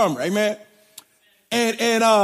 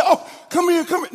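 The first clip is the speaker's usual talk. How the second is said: fussing parents; 8 semitones higher than usual. Retold or imitated. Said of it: "they said".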